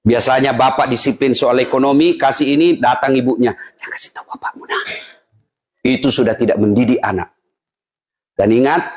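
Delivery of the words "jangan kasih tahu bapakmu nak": put-on whispered secret to the child; conspiratorial whisper. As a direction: hush it from the father. Mother secretly spoiling child. (berbisik diam-diam)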